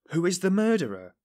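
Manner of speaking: high, loud, incredulous echo. A narrator's reading. Investigator amazed at the suspect's question, incredulous. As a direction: repeat it as if you can't believe your ears. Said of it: "as though unable to believe his ears".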